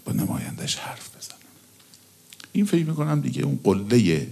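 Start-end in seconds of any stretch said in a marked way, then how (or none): 0.0-1.4 whisper. Real, not acted. Intimate wish to speak privately.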